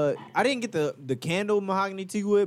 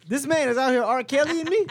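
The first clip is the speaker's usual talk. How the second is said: outraged disbelief; 7 semitones higher, 3 dB louder than usual. Real, not acted. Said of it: "Do not ever say that sentence".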